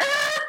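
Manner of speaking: very high-pitched tire squeal; imitated screeching brakes. Dad's car stopping hard beside him, imitated screech. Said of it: "He, like, stopped hard".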